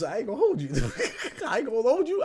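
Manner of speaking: high, loud, laughing through the words. Real, not acted. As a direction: crack up mid-confession. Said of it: "I'm laughing now".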